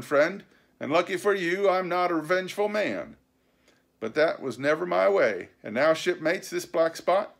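high, crowing pirate captain; triumphant sea cook Silver. Acted. Silver addressing George and shipmates after winning, triumphant. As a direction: lord it over the loser, then address the crew.

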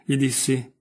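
plain reading voice

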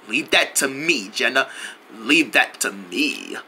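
low, slow and soft; smooth self-assurance. Acted. Jungle assures Jenna he will handle it, low and slow. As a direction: drop it low and draw it out. Confident reassurance, said slower the second time.

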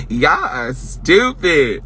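drawn-out, amused scold; slow put-down. Acted. Streamer scolding chat slowly, amused. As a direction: stretch every word out.

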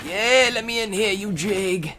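high loud jeer; cocky jeer at mobs; mock taunt. Real, not acted. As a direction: taunt the mob. Taunting mob.

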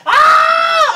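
sudden high loud yelp; shocked shout. Real, not acted.

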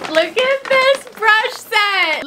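high, drawn-out squeal of delight; excited squeal. Real, not acted. Showing off the new brush set, excited squeal.

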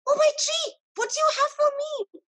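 high, eager question; bursting with curiosity; excited. A narrator's reading. Excited man begs the tree for the surprise, high. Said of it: "very happy and excited".

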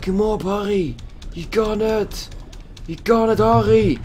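rally Harry on like a coach; cheering on. Real, not acted. Gamer cheering his character on, high and eager.

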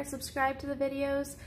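ordinary delivery, nothing marked

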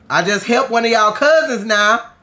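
high, loud outraged complaint; indignant yell. Real, not acted. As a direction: shout your betrayal at them. Villagers attack after help.

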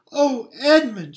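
high, loud excited cry, slowed; Lucy thrilled to see him; cried out. A narrator's reading. Lucy crying out on seeing Edmund, high and excited. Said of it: "she cried".

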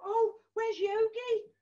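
usual speaking voice

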